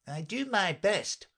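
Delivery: mildly sulky, high-pitched messenger. A narrator's reading. Messenger defends himself to the King, sulky. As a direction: grumble defensively in a high character voice. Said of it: "said in a sulky tone".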